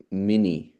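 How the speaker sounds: mini with a single n; the disallowed mini; wrong form. Shown as a demonstration. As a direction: say mini, one short n. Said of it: "you can't say".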